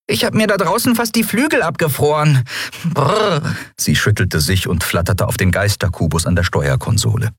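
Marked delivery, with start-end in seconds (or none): 0.1-3.4 very high piping little creature, shivering; tiny high-pitched complainer; squeaky Nelen. A narrator's reading. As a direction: squeak the grumble and end on a brr. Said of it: "piepste Nelen".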